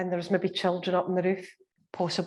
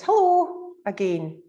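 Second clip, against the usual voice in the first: Scottish female voice; 2 semitones higher, 5 dB louder, slower than usual. Retold or imitated. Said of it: "very distinctly female and distinctly Scottish".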